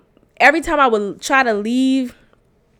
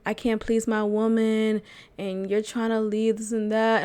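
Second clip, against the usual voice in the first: softer, mopey mock sulking; mock whiny sulk; 3 semitones lower, 7 dB quieter than usual. Boyfriend pouting to girlfriend, mock whiny. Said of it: "super baby type of response".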